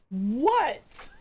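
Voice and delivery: mild mock annoyed "What?"; imitating an annoyed snap. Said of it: "how angry he sounds when he was just like".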